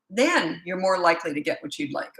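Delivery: ordinary talk, nothing marked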